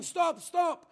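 high, loud cry to stop; shouting. Retold or imitated. Girl crying out to the soldiers to stop the horse, loud. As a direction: cry out for them to halt. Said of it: "she starts shouting".

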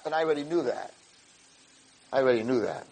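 very soft, knowing aside; hushed aside. Retold or imitated. Friend who saw the game, hushed aside. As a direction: drop right down after the shouting.

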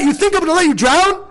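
high-pitched, indignant parental disbelief; acted upset dad. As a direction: act offended that the child doubts you. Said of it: "then you get so upset".

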